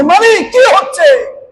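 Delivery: high-pitched acted outcry; crying out. Angels crying to the Lord, high outcry. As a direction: call upward in pleading protest, without real tears. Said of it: "চিৎকার করে কান্না করে বলছে".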